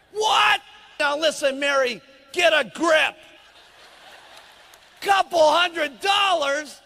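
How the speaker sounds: loud, high scolding of Mary; husband yells at Mary over the money; angry husband shout. Retold or imitated. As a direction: yell at her in outrage. Husband yelling at wife.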